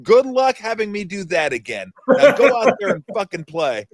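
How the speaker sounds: laughing through an imitated coach's rant; mock stern coach, cracking up as he tells it. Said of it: "He goes".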